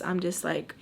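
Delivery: ordinary talk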